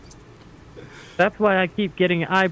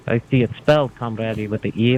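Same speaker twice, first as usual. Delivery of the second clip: ordinary delivery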